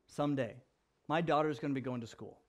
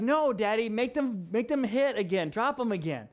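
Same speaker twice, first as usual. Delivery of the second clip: put-on higher, louder little girl demanding; imitated insistent child; 5 semitones higher, 4 dB louder than usual. Little daughter asks Daddy to drop blocks, imitated insistence. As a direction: plead like an eager small daughter.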